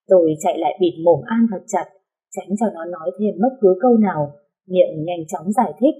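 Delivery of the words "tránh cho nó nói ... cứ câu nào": ordinary delivery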